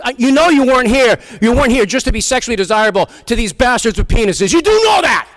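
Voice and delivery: loud, high, overprotective dad outburst; hammed-up rant climbing to a shriek; mock fatherly rant. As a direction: lecture your daughter at comic full volume. Acting out father's rant to daughter.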